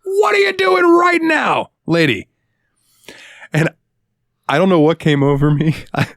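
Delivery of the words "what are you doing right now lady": high, slow shouted challenge; mock shout. Cashier retells shouting at a customer in a slow high yell. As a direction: yell it at her, stretching it out. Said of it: "Is exactly what I said".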